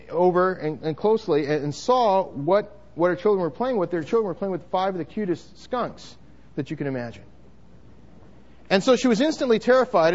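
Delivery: ordinary talk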